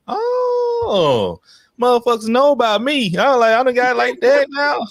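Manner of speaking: squeaky excited falsetto, hammed up; mock himself in a high squeal. To Stacy, a high hammed-up squeal of surprise.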